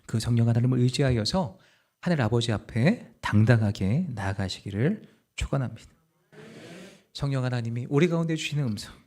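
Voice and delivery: ordinary talk, nothing marked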